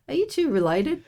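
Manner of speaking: high, rising mock question; curious onlooker. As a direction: lightly imitate a curious stranger, pitched high. Voices onlookers asking the friends.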